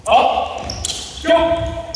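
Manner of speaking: high shouted go; called start. Real, not acted. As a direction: snap the start call to the players. Coach starting the drill.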